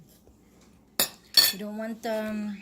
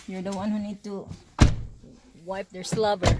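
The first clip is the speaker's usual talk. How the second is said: ordinary talk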